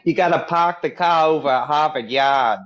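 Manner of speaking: put-on Boston accent. Panelist answering a request, mock Boston accent. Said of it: "How about a Boston accent?".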